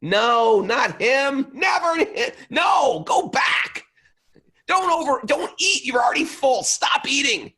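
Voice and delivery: high, loud commanding shouts; bossy spiritual GPS. Pretend. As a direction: bark orders like an insistent sat-nav. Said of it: "we need a spiritual G P S".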